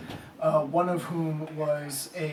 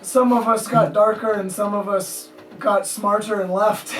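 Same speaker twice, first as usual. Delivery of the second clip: loud gruff Scottish barkeep; Scottish accent; 5 semitones higher, 8 dB louder than usual. Acted. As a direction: boast it like a Scottish bartender. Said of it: "a gruff, uh, Scottish accent".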